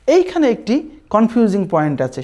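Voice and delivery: ordinary talk, nothing marked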